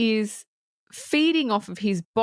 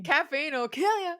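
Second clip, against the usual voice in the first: raised-pitch playful quote; imitated villain's line; 5 semitones higher than usual. Host echoing the corrected quote, light villain imitation.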